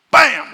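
shouted impact noise; sudden loud bam. Retold or imitated. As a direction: slam it like a tackle. Sound of a football hit.

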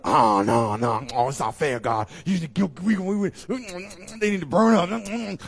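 loud, high, complaining prophet; mock protest from Jonah; whiny Jonah. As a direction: whine that it's not fair. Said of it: "Yet Jonah".

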